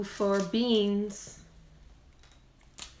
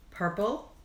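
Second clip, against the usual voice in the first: ordinary talk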